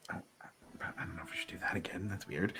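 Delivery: hushed, nervous whisper; soft worried whisper; uneasy whisper. Acted. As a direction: whisper it, unsettled. NPC uneasy murmur.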